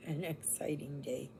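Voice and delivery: low, soft flat-toned repeat; dry sarcastic mutter; deadpan. Pretend. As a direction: let the enthusiasm drop away.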